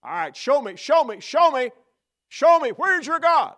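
from plain to high, loud challenge; building taunting demand. Retold or imitated. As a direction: escalate into a jeering demand. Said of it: "crying out and saying".

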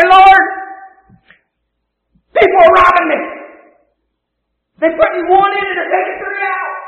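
a preacher hamming up a complaining beggar, loud; mock whiny beggar. Preacher mocking a beggar's selfish prayer, mock whine. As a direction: whine to Jesus about the handouts.

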